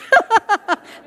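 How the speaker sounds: a burst of high, loud laughter. Real, not acted. Preacher laughing at her own story, high and loud.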